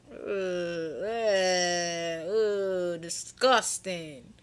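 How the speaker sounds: drawn-out groans of revulsion; disgusted. Acted. Teller reacting to old man's pickup lines, exaggerated disgust. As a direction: groan it out, hammed-up and grossed out.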